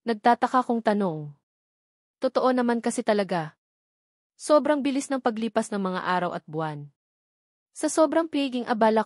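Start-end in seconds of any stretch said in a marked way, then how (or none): none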